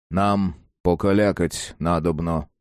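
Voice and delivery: unhurried, weighty request; folksy priest. Acted. Priest asks others to leave for a chat, folksy priest.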